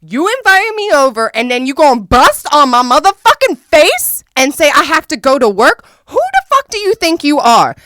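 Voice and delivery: screamed high and loud at him; retold furious yelling. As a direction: tear into him, outraged. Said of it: "And so I started going off".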